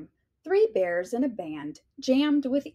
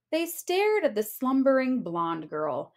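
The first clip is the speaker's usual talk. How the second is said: usual speaking voice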